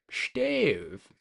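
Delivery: put-on fancy British pronunciation, long falling vowel; playful fancy British accent. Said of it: "a fancy British accent".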